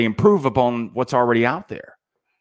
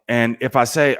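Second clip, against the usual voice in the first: ordinary talk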